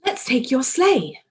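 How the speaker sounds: rally him to the sleigh; excited cry. A narrator's reading. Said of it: "cried Paddington".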